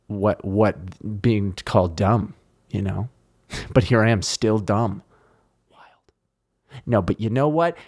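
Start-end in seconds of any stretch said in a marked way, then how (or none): 5.7-6.8 whisper. Real, not acted. Aside to himself.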